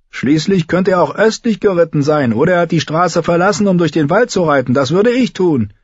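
put-on high, lively boyish debate voice; young rider reasoning. A narrator's reading. Story figure debating the route.

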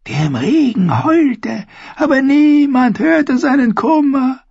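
high, slow dreamy crone voice; high old woman, slow and mournful; old dwarf seeress. A narrator's reading. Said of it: "sagte die Zwergenfrau".

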